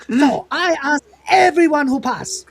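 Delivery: put-on high, loud caricatured Taiwanese accent; Taiwan Tony.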